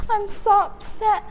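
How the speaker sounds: high mock-upset whine; whiny retold protest in a high voice; upset complaining. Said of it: "complaining to my mom".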